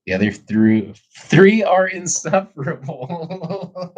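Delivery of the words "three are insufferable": breaking into giggles, pitched up high; can't hold back laughter mid-sentence; laughing. Real, not acted. Laughing while telling chat about his brothers. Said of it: "I'm laughing".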